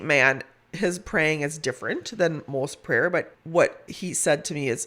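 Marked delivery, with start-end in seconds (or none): none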